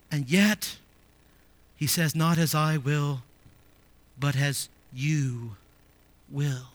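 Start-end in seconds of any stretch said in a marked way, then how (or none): 2.1-6.7 low, soft quote with long pauses; solemn hushed prayer. Retold or imitated. Preacher repeats Jesus's prayer of surrender, solemn and hushed. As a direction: let it fall away word by word, slowly.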